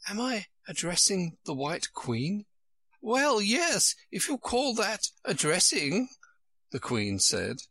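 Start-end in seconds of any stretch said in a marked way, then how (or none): 0.1-2.4 polite, higher, tentative question; mildly timid young heroine. A narrator's reading. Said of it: "she began rather timidly". 3.0-6.1 very high-pitched storybook queen; high Queen correcting Alice; fussy Queen. A narrator's reading.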